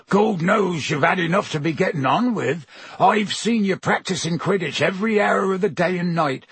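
big, rough-hearted gamekeeper voice; Hagrid voice. Acted.

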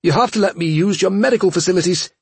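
fast high pleading; panicked. Acted. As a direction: beg urgently, rushing.

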